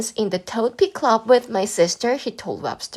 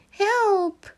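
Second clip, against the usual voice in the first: high, strained call for help; muffled high yelp for help; cry for help; 13 semitones higher, 3 dB louder than usual. A narrator's reading. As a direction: call out trapped inside the shirt. Stink stuck in his shirt.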